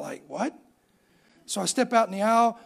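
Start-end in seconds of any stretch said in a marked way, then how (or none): 0.3-0.6 freaking out. Retold or imitated. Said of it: "I'm freaking out".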